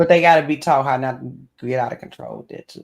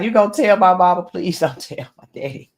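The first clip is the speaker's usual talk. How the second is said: mocking scared kid; 5 semitones higher, 5 dB louder than usual. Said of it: "Be like".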